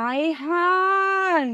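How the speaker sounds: very slow drawn-out আয় হায়; dismayed gasp. Acted. Wife gasping slowly on learning the prank. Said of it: "এখন আয় হায় করতেছো কেন".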